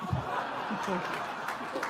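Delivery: laughter. Real, not acted. Laughs at own joke.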